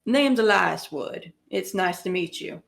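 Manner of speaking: slow, easy Southern drawl introducing himself; Southern accent, unhurried and friendly. Acted. Character greets new companions in a slow Southern accent. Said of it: "Why are you Southern?".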